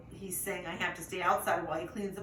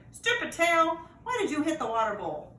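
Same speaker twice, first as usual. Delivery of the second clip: loud, higher scolding bark; angry bark; 8 semitones higher, 6 dB louder than usual. A narrator's reading. Puppy scolding his own tail, loud angry bark. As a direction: tell the tail off, loud and cross. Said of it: "bark at my rear end".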